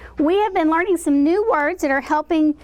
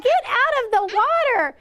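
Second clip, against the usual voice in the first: high-pitched story-crowd cry; mock shrieked warning; 7 semitones higher than usual. Teacher voicing the crowd at the beach, mock shrieked warning.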